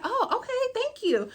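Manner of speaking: high-pitched polite thank-you; sweet thanks. Retold or imitated. Woman accepting a seat from a guy, sweet high thanks.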